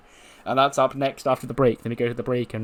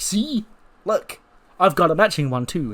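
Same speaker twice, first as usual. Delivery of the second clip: put-on much higher, childlike excitement; giddy show-off; 6 semitones higher than usual. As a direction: show it off like a thrilled kid. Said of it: "It's like".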